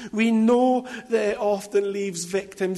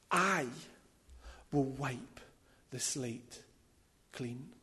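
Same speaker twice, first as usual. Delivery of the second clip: hushed divine promise; 7 semitones lower, 14 dB quieter than usual. Acted. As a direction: breathe each phrase out quietly with long pauses. Preacher voices God's gentle promise.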